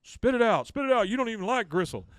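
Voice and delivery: loud, high mock outrage; hammed-up scolding at high pitch; acted angry shout. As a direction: yell it as an exasperated father's order. Acted father quarrelling over gristle.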